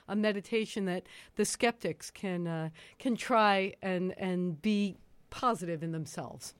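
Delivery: ordinary delivery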